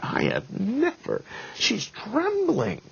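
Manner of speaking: very high, slow whispery squeal; hammed-up, squeaky disbelief; mock aghast. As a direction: imitate a stunned friend, stretching each word in breathy falsetto. Friend aghast at the judge's state.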